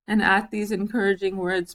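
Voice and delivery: usual speaking voice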